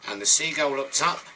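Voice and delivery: plain reading voice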